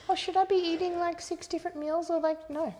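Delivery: high, fussy questioning client; whiny client voice. Retold or imitated. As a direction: play a confused, anxious client.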